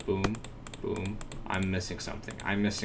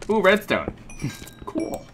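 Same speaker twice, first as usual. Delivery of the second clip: ordinary talk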